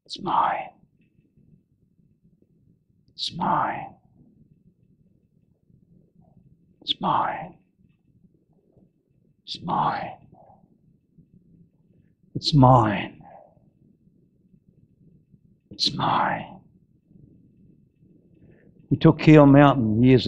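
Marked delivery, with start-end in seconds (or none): 0.0-13.2 soft, breathy whisper, with long pauses; whispering it's mine to himself on the mountaintop; whispered claim. Acted. Quiet repeated claim. 15.7-16.6 soft, breathy whisper, with long pauses; whispering it's mine to himself on the mountaintop; whispered claim. Acted.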